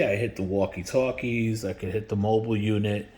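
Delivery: normal talking voice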